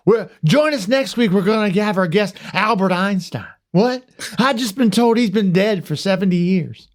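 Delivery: high, hammed-up sportscaster sign-off; Will Ferrell as Harry Carey. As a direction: announce it like a daffy baseball caller. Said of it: "I wanna do Will Ferrell's version of him".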